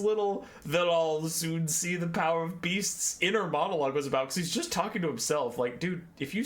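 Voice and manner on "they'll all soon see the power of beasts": slow, menacing brooding villain; mock villain line. Narrator mocking the Patriarch's grand boast, slow and ominous. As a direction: growl it out like a self-important sorcerer.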